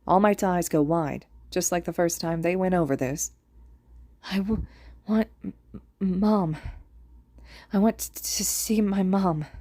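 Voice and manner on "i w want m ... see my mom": slow, broken whisper asking for mom; stammering dying child. A narrator's reading. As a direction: whisper it weakly, stuttering. Dying student asks for mom.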